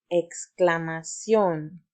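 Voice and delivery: exclamación spoken slowly and distinctly; slow model word. Shown as a demonstration. Teacher models exclamación for children to repeat, slow and clear. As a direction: model exclamación for the kids to echo. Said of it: "repite conmigo".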